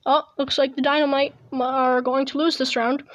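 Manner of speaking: normal talking voice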